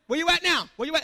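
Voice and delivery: taunting call-out. Acted. Taunting the congregation.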